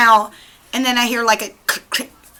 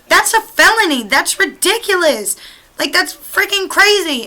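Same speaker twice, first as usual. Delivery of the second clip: higher, incredulous exclamation; outraged disbelief; 6 semitones higher than usual. Retold or imitated. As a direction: react as if you can't believe what they did. Shocked at friends' copper theft.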